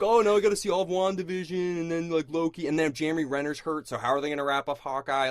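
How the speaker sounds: loud mock-fanboy ramble, easing down; mocking ranting fan. Co-host imitating his friend's Marvel obsession, loud and mocking. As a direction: parody a breathless, overinvested franchise fan.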